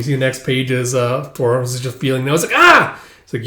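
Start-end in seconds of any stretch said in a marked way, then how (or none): 2.5-3.0 short high, loud pained cry; acted sharp scream; yelp of pain. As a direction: yelp as the sore spot is touched. Boy's broken bone is prodded.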